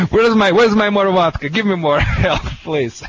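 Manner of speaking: high, laugh-broken mock begging; laughing plea. Real, not acted. Dog trainer jokingly begging for vodka, laughing and high. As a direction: beg through the giggles, pitched well up.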